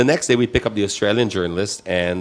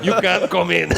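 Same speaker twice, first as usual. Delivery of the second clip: a hammed-up African accent, higher and laughing; put-on African accent; 7 semitones higher than usual. Telling friends about the border officer, hammed-up African accent. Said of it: "for lack of a better accent".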